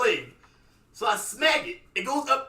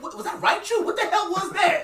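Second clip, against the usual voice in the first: startled; 11 semitones higher, 5 dB louder than usual. Retold or imitated. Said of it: "I was like".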